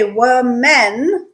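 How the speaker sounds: women slowly as wo-MEN; as spelled. Shown as a demonstration. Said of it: "'Cause that's how it's written".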